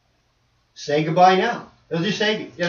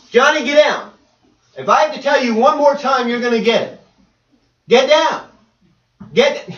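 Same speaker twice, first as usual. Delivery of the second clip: loud, raised-pitch acted scolding; scolding parent; 7 semitones higher, 6 dB louder than usual. Parent ordering Johnny down, scolding loudly. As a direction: shout a mock last warning.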